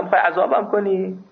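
ordinary talk, nothing marked

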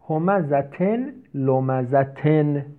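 each word stretched slowly; slow reading. Shown as a demonstration. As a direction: spell the words out slowly for students. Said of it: "لمزه هم صفت همزه است". (کشیده و آهسته)

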